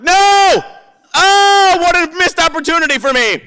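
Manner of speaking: hammed-up high, loud cry of dismay; anguished wail. Replays his dismay on reading script.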